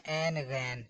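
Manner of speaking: short عین غین rhyming on "en"; wrong letter names. Shown as a demonstration. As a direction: say عین غین briefly, without the drawn-out glide. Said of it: "یہ غلط ہے".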